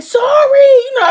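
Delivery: high, loud, drawn-out squeal; mock screamed apology. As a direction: shriek it long as a playful surrender.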